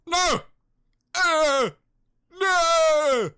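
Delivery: high, loud hammed-up no; mock horror shout. [name] rejecting his new drawl with a loud shout. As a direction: shout the no in fake panic.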